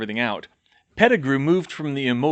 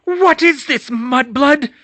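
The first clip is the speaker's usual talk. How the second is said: high, loud mad witch; crazed witch snarl; 11 dB louder, slower than usual. Acted. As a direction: spit the insult. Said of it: "she hissed".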